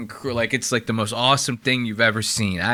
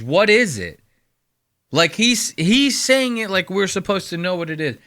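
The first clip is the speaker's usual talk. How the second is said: ordinary talk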